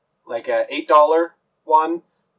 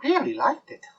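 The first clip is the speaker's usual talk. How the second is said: high, softer fairy voice close in; confiding fairy; 6 semitones higher, 6 dB quieter than usual. Acted. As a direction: murmur it from his shoulder. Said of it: "stands on your shoulder and goes".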